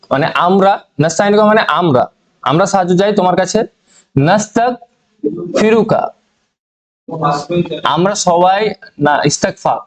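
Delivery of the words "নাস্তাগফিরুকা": নাস্তাগফিরুকা very slow, each syllable apart; slow recitation. Shown as a demonstration. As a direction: recite নাস্তাগফিরুকা slowly for class. Teacher recites dua word. (খুব ধীরে নাস্তাগফিরুকা উচ্চারণ)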